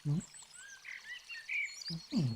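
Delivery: usual speaking voice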